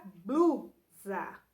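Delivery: "blusa" split, with a strong BLU; syllables sounded out. Shown as a demonstration. Teacher giving pupils an example word, syllables sounded out. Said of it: "em voz alta para perceber os sons".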